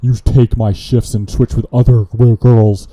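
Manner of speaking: a coworker griping in a put-on voice; wrong put-on voice. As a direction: put on a different voice to gripe about a coworker. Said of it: "I was so focused on the voice".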